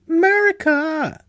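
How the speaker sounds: very high, loud, drawn-out mock cry; mock patriotic cry. Co-host opening a moon question, mock patriotic holler. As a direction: belt it out with hammed-up patriotism. Hammed-up patriotic name of country.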